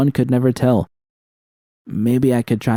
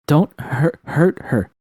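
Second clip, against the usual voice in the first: higher, broken, frightened plea; sputtering; 7 semitones higher, slower than usual. A narrator's reading. Captive begging the villain to spare her, sputtering higher. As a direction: stumble over the words in panic. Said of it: "I sputtered".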